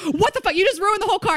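high-pitched mock outrage; dad going crazy. Dad to daughter over the ruined car, retold freak-out. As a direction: imitate a dad losing it, voice shooting way up. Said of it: "He's going crazy".

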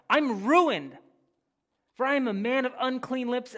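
high, loud cry of despair; loud anguished confession. Acted. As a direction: wail it out. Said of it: "I cried".

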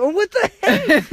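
very high, loud squeal of mock outrage; mock shock. Teen insulted over hockey game, squeaky mock shock. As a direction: yelp like you can't believe it. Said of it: "I'm like".

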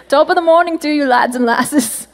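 a playful stage-Irish greeting; mock Irish accent. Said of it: "I think that might be Irish".

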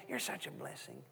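put-on hushed whisper to a sleepy child. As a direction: breathe it softly like a bedtime blessing. Night prayer with little son.

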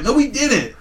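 high-pitched retold contradiction; acting out a stubborn comeback; indignant denial.

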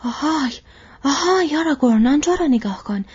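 high, loud call across the water; alarmed shout. A narrator's reading. Boromir calls to Aragorn from the boat behind, shouting. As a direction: shout a warning to a companion. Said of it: "فریاد زد".

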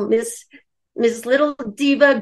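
normal talking voice

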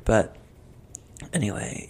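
usual speaking voice

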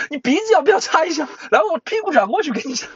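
high joking voice. Retold or imitated. Joking tester voice. (高声开玩笑地演检测员)

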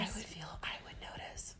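hushed whisper. Pretend. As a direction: breathe the words, no voice. Playful hushed back-and-forth.

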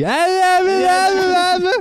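high, loud mock chanting of noisy students. Friend imitates the noisy class for the teacher. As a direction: mimic a class droning ah ah ah. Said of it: "Berisiknya kosong lagi".

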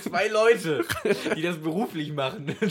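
high, loud, laughing. Real, not acted. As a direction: giggle through the joke. Lachender Einwurf.

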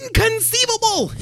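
high, outraged lisping squeal; Vizzini impression. Vizzini scolding his henchmen, high and indignant. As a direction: shriek it in disbelief. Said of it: "That was actually pretty good".

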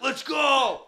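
excited. Retold or imitated. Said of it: "I'm like".

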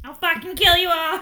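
hammed-up fake gunman; mock robber threat. Bluffing robber to his victims, mock threat.

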